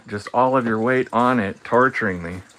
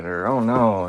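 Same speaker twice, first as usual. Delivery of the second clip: ordinary delivery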